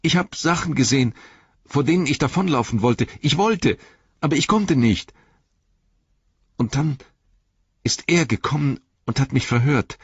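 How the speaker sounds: high, distressed hobbit voice; Pippin. A narrator's reading. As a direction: confess haltingly, frightened. (verängstigter Hobbit, hohe Stimme)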